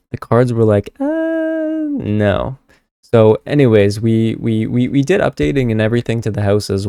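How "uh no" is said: cards' voice. Retold or imitated. Tarot cards answer in voice.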